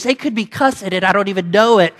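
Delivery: normal talking voice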